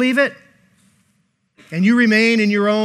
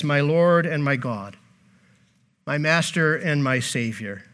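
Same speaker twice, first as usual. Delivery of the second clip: reverent confession; 5 semitones lower, 6 dB quieter than usual. Retold or imitated. Thomas confesses Jesus.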